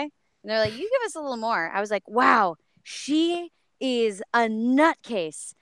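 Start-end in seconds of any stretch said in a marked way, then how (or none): 0.7-1.7 higher-pitched coaxing quote; lilting retold nudge for more energy; imitating a director's repeated request; director urging more. 2.1-5.5 slow, higher, drawn-out wonder; playfully stunned, word by word; amazed realization. Retold or imitated. Retold amazed reaction to wild character.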